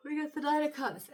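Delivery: catching breath after hiccups. Real, not acted. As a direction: voice jolted by hiccups. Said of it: "I have the hiccups".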